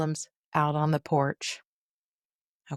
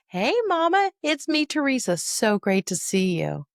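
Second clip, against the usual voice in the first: bright, much higher, louder hello to mom; cheerful greeting; 6 semitones higher, 3 dB louder than usual. Shown as a demonstration. Daughter greeting her mother with delight, cheerful. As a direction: greet her like you're thrilled to see her. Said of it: "we introduce ourselves".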